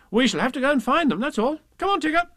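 put-on high, quick boyish resolve; brisk Christopher Robin. Christopher Robin rallies Tigger, quick high boyish voice. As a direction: take charge like a boy.

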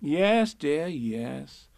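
softening, indulgent hush; soothing. A narrator's reading. Kanga calming the protesting Piglet, soothing. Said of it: "said Kanga soothingly".

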